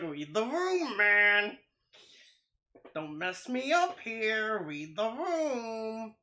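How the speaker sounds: mock-plead through amusement, stretching the words; imitated exasperated friend. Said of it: "He's like".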